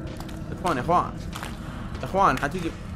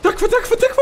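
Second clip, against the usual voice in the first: panicked pleading; 6 dB louder, faster than usual. Real, not acted. Begging the monster.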